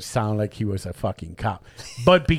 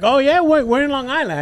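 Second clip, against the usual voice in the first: mock eager grilling; 12 semitones higher, 4 dB louder than usual. Mock-eager questioning of fake cop.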